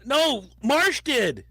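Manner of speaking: slow, high protest; drawn-out protest. Real, not acted. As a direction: stretch the denial out. Protesting slowly.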